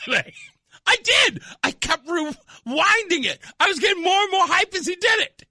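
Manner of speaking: bubbling over with glee; hyped up. Real, not acted. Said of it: "that hypes me up".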